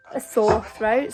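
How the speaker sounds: ordinary talk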